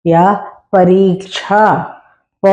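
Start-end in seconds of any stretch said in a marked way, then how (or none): none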